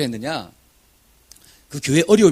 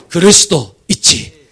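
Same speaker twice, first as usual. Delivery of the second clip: loud, slow proclaimed line; preached proclamation; 9 dB louder, slower than usual. Retold or imitated. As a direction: declare it slowly from the pulpit. Preaching the phrase at dawn.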